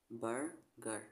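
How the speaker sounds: ordinary talk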